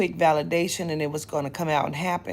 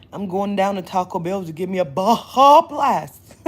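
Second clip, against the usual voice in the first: louder joking put-on voice, Baja stressed; 8 dB louder than usual. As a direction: ham up the Taco Bell run. Playful put-on loud line.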